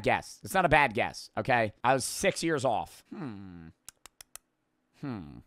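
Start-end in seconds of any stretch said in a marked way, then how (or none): none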